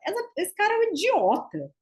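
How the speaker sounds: a high mock scoff at the idiot; scornful aside. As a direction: sneer it lightly, half-joking. Retold inner scornful remark. (desdém fingido e leve)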